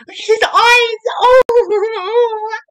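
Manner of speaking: loud, very high squealing; overwhelmed fangirling. Real, not acted. Fan finally seeing their favourite's eyes, overwhelmed squealing. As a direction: wail with delight, almost crying.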